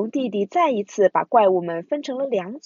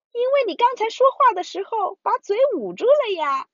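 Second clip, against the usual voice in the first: put-on high child voice; 12 semitones higher than usual. Bear brother explains cheerfully.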